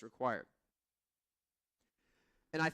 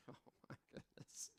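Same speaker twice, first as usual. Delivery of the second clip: tired sigh; 21 dB quieter than usual. Retold or imitated. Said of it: "I'm like".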